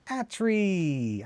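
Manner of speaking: high call across the room. Real, not acted. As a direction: shout the name to get attention. Calling out to someone.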